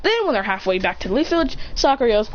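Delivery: ordinary delivery, nothing marked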